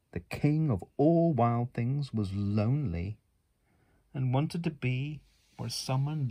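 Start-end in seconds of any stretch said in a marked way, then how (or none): none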